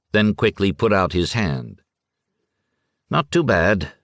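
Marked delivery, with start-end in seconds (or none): none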